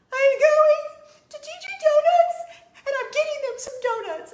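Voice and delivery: high, loud, bursting announcement; squealing out the plan to her husband; excited announcement. Retold or imitated.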